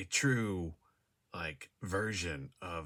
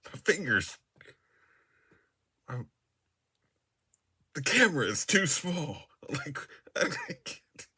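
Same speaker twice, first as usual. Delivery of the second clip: laughing; 9 semitones higher, 8 dB louder than usual. Real, not acted. Giggling over camera framing.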